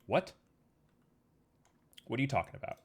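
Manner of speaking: ordinary talk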